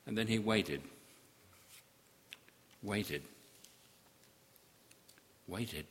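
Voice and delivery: fading, dropping repetitions; fading away. Acted. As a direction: let the waiting die out.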